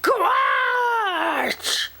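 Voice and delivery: very high squashed shout; father flattened by a moose; crushed cry. Acted. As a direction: cry out as the moose lands on you.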